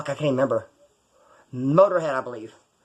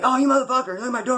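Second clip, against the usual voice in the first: higher, hammed-up outraged driver; mock angry driver; 8 semitones higher than usual. Driver whose door got hit, yelling at him in mock outrage. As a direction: do the furious driver yelling over his car door.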